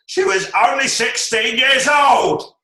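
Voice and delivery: high, loud, slow, voice cracking with emotion; cracking Caine voice. Retold or imitated. Mocking old Michael Caine's emotional crack, high loud and slow. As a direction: let the old man's voice break on it. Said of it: "his voice starts to crack".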